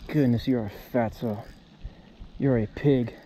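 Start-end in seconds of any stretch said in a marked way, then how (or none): none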